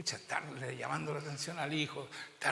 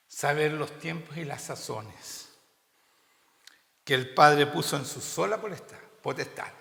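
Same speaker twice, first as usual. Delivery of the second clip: preaching scripture at full force, loud and slowed; loud scripture reading; 9 dB louder than usual. Acted. Preacher reading Acts aloud to his congregation, loud and deliberate. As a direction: proclaim the verses out loud to the church.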